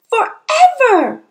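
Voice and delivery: put-on high, loud, drawn-out child's cry; distraught little-girl outburst; anguished cry. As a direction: stretch it out in dismay. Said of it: "Matilda cried".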